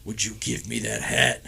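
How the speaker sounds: deep, soft menacing ask; imitating the Undertaker's deep voice; dead man voice. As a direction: drop low and sinister for the request. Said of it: "And he goes".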